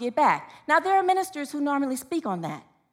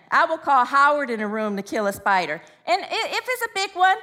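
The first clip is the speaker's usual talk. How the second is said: ordinary talk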